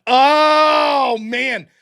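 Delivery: loud amazed outburst; surprised shout. Real, not acted. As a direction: blurt out in disbelief. Reacting to outrageous chat.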